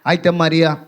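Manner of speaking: ordinary talk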